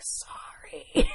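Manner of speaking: whispered, sheepish apology; awkward whisper. Retold or imitated. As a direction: mumble it like an embarrassed drunk. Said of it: "super awkwardly and was like".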